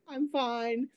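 high, small mock-tearful voice; imitated bawling. Bawling traveler reassures airport security, imitated high tearful voice. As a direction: squeak it out softly, imitating someone in tears. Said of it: "I'm, like, bawling".

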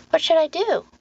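put-on high, uncertain child voice; little boy. Boy asking Lenora for help, small and high.

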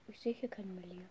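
whispered aside. Real, not acted. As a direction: drop to a whisper. Flüstert. (flüsternd)

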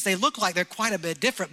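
ordinary delivery

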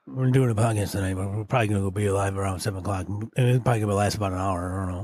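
fast mumble. Shown as a demonstration. Mocking fast mumbled aside.

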